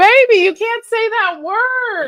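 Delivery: high, slow shocked scolding; shocked outburst at a banned word. Real, not acted.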